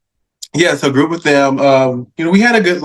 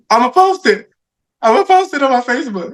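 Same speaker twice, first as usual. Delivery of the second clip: excited; 10 semitones higher than usual. Retold or imitated. Retelling his excited plan.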